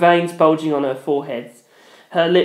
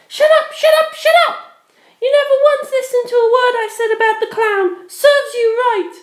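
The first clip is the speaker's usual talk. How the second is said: high, loud repeated shut up; furious shout; 4 dB louder than usual. Acted. Penelope shouts down her ex, loud and furious. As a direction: drown him out in fury.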